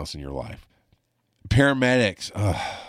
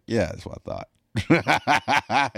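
knowing; much slower than usual. Retold or imitated. Catching a faked seizure.

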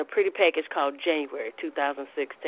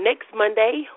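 usual speaking voice

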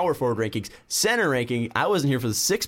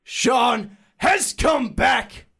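long drawn-out shout, much higher; shouted announcement; 10 semitones higher, much slower than usual. Acted. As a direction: bellow it like a ring announcer. Intro climax shouted announcement.

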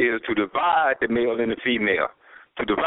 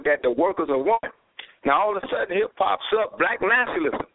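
fiery preaching; 5 semitones higher than usual. Real, not acted. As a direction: pitch rises as the sermon-style talk builds. Preaching at rising force.

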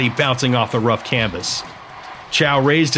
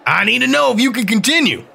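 higher, insistent official's voice; demanding referee; 10 semitones higher than usual. Acted. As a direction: press him hard for an answer as the ref. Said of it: "the ref demanded".

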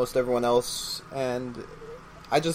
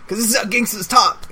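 gangster voice; 6 semitones higher than usual. Acted. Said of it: "in a gangster voice".

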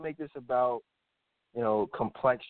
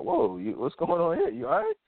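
rising higher in surprise, the last question jumping way up; startled whoa; 6 semitones higher than usual. Retold or imitated. Date opens the door in a towel, startled retelling. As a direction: caught off guard, voice climbing. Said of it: "I'm like".

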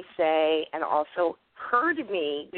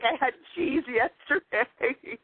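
high, drawn-out mock excuse; sheepish confession; 6 semitones higher, slower than usual. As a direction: own up sheepishly, stretching it out high.

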